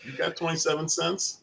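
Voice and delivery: snide rising question; snotty worker. Retold or imitated. Mimicking the snide drive-thru worker, snide question. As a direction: sneer it like the bored worker. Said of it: "He's just like this".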